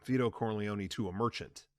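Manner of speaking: ordinary delivery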